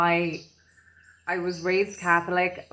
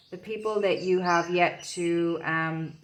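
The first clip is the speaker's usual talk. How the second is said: normal talking voice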